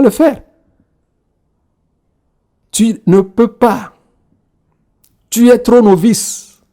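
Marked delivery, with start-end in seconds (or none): none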